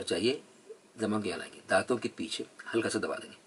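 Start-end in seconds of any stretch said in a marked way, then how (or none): none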